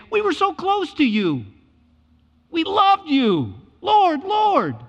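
very high, loud, slow begging; desperate plea. Retold or imitated. People begging Jesus to let them in, loud high plea. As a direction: stretch out each word, pleading. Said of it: "pull it out and say".

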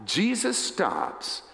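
normal talking voice

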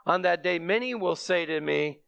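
ordinary talk

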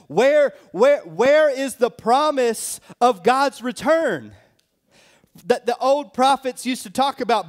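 high, loud sneering mock voice; mocking scoffers. Preacher mocking the scoffers, high loud sneer. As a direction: jeer at the believers like a scoffer. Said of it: "he starts mocking them".

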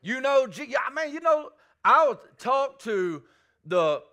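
fervent Southern preacher calling out; preaching at full force. Acted. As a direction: drive it home like a revival preacher.

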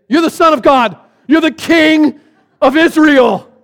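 high, loud, fervent declaration; awestruck proclamation. Retold or imitated. Nathaniel recognizes the Son of God, proclaiming loudly. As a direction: cry it out phrase by phrase.

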